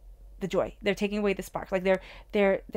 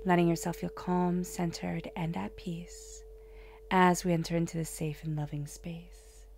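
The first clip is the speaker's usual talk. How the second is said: guided meditation. Acted. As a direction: whisper them into calm. Leads a calming opening.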